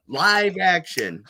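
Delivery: slow, drawn-out TV catchphrase; quoting Turtle Man's big intro line. Retold or imitated. Host quoting Turtle Man to the guest, drawn-out catchphrase. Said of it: "Turtle Man used to say".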